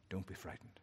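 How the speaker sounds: low, hushed words of comfort; soft reassurance. Acted. God softly comforting the frightened prophet, hushed. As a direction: soothe him gently, almost under the breath.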